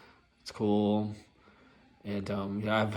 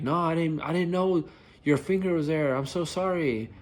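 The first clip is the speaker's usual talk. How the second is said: flustered apology; 7 semitones higher, 3 dB louder than usual. Retold or imitated. Apologizing to angry passenger.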